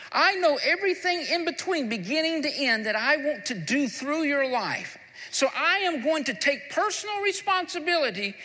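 high, loud preaching voice, pressing each promise home; full-force preaching. Acted. Pastor voicing Jesus' promise to the congregation, loud preaching. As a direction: proclaim it with rising fervour.

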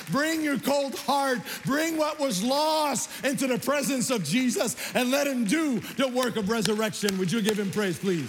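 high, loud revival preacher; full-force preaching. Real, not acted. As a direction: build to a ringing call to the congregation.

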